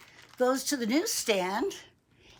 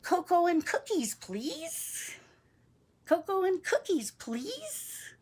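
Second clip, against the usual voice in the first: mom's sweet order; 9 semitones higher than usual. Acted. Mom ordering treats in the cafe, sweet. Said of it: "or she says".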